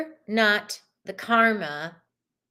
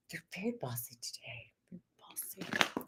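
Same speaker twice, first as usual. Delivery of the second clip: whispered aside, much softer; 3 semitones lower, 16 dB quieter than usual. Real, not acted. Reader whispering about bossy tarot cards while shuffling. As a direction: confide it under your breath.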